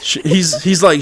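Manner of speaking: normal talking voice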